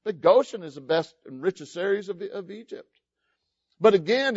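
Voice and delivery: ordinary delivery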